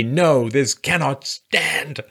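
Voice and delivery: very slow, drawn-out solemn proclamation; mock solemn slogan. Mocking a campaign slogan for viewers, slow and solemn. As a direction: stretch every word like a campaign ad. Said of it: "Vote this November to say".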